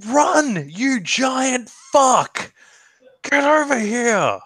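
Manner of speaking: high shout with the insult drawn out; impatient yell. Acted. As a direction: bellow at him to hurry up. Hurrying Fargo across beach.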